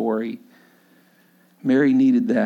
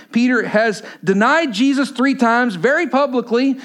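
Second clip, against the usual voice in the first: preaching scripture at full force; 9 semitones higher than usual. Acted. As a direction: proclaim the verses to the congregation.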